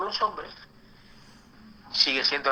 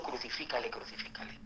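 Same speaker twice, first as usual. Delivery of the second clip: a hostile cry whispered, much softer; whispered crowd cry; 2 semitones lower, 13 dB quieter than usual. Retold or imitated. Preacher voices the crowd turning on Jesus, whispered. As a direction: breathe the demand almost silently. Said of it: "está diciendo".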